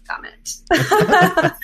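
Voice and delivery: laughing mock curse at herself; giggling self-scolding. Real, not acted. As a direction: toss it off through a high laugh.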